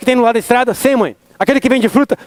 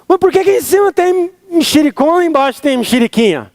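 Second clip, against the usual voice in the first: put-on curious child; 8 semitones higher than usual. Child asking mom in story.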